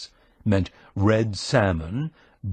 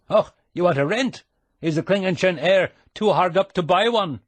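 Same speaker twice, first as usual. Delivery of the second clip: fast, higher teasing Scottish brogue; 8 semitones higher, 4 dB louder than usual. A narrator's reading. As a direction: needle him in a quick Scottish brogue. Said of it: "putting on the brogue".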